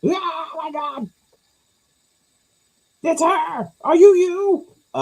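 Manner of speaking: very high, drawn-out fan squeal; starstruck shrieking. Acted. Fan spotting a film star, mock starstruck shriek. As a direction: squeal like a hammed-up superfan.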